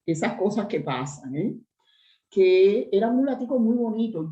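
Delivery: ordinary delivery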